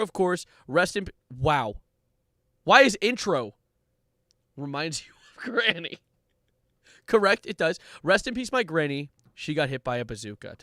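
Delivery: ordinary talk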